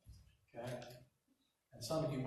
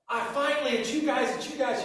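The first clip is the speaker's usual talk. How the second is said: excited waitress; 9 semitones higher, 7 dB louder than usual. Retold or imitated. Waitress overjoyed at reunion.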